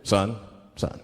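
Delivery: a cop at the car window; officer questioning a stopped driver; stern cop. Retold or imitated. Cop voiced in story.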